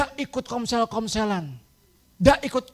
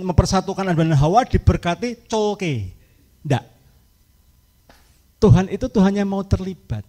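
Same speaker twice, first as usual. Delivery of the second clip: normal talking voice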